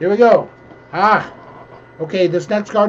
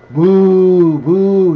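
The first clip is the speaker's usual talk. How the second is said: loud playful boo at the card; jeering sports fan boo; mock booing; 2 semitones higher, 7 dB louder than usual. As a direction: boo him like a fan in the stands. Booing a suspended player.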